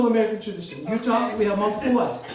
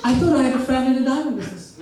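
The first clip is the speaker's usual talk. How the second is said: commercial voice; 2 semitones higher, faster than usual. Retold or imitated. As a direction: punch it like a TV jingle line. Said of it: "I tell them what?".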